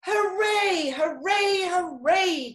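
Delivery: high, loud storybook cheer. A narrator's reading. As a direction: cheer like a crowd of animals. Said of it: "cheered the other animals".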